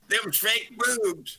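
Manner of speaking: put-on high, slow old Southern lady accent; old Southern gal. Retelling what the old gal told Robin, high Southern accent. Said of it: "In the Southern accent".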